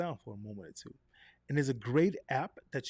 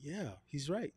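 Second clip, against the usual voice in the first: inner voice; 7 dB quieter than usual. Retold or imitated. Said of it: "your brain to say".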